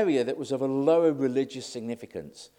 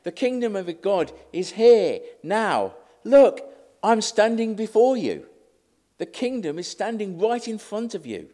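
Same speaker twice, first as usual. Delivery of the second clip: higher, louder declaration; emphatic proclamation; 6 semitones higher, 6 dB louder than usual. Retold or imitated. Jesus tempted to announce the kingdom, emphatic proclamation. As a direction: proclaim it with force, hitting LOOK. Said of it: "Jesus felt tempted to say".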